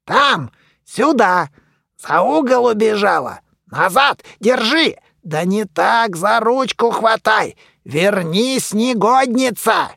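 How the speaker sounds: excited shouting commands. A narrator's reading. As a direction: bark orders while chasing. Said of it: "Мумий орал и тыкал пальцем".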